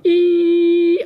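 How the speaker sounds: high, loud sustained squeal like a rubbed glass; piercing mock glass hum; imitated wine glass hum. Shown as a demonstration. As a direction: sing out the ringing note of a wet wine glass. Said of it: "licked your finger and gone".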